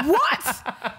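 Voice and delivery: ordinary talk, nothing marked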